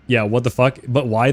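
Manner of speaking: ordinary talk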